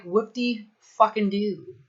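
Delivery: slow, dry, unimpressed "whoop-de-do"; sarcastic mock cheer. Coach voicing the client shrugging off weight loss, slow sarcastic. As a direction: drag it out, utterly unimpressed. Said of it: "You're gonna be like".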